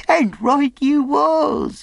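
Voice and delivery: high, loud, slow castaway cry. A narrator's reading. Castaway agrees with the boy, crying out. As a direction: exclaim in a high, drawn-out character voice. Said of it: "he cried".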